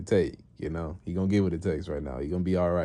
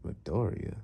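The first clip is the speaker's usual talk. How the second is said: puzzled musing; 5 dB quieter than usual. Retold or imitated. Master puzzles over the name.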